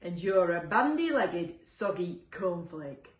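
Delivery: slow, drawn-out character insult; scornful monster voice at normal pitch; second monster retorting. Acted. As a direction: fire back loudly, savouring each word. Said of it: "replied the second monster".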